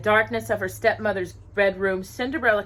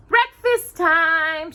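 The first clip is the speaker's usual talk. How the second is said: much higher, louder and slow, drawn-out call; 10 semitones higher, 6 dB louder, much slower than usual. A narrator's reading. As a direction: call the animals across the yard. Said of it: "she called".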